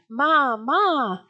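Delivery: high, drawn-out cry for mother; mock shriek. A narrator's reading. Frightened children run to the tent, mildly shrieking for Ma. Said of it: "shrieking and crying".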